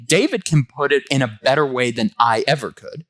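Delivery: usual speaking voice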